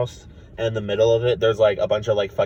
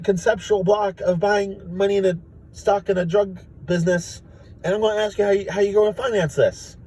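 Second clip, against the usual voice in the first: high, anxious Morty voice; Morty impression; 11 semitones higher than usual. Morty questioning Rick about money, high and worried. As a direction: fret like nervous Morty. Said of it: "Morty's like".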